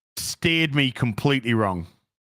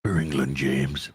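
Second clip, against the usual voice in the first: very low, hushed Yorkshireman trying to speak posh; Sean Bean; 13 semitones lower than usual. Acted. Streamer answering a viewer's request, deep Sean Bean impression. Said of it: "Do you mind doing a Sean Bean impression".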